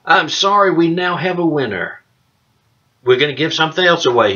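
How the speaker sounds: bright announcer voice; radio announcer. Pretend. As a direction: proclaim the winner on air. Announces the winner.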